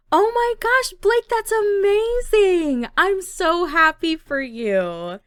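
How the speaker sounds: high, loud, slow gushing squeal; hammed-up overjoyed girlfriend; thrilled. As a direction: stretch out the squeal of congratulations. Girlfriend celebrates his acceptance.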